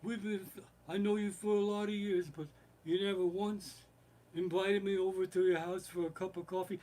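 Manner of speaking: imitated crime boss, high and held-back; Godfather. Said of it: "and he says".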